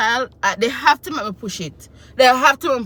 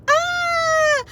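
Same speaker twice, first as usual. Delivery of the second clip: newborn's first cry. Retold or imitated. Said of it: "me hear".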